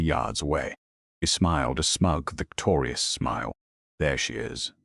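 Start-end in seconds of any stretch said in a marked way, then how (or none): none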